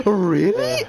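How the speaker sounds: normal talking voice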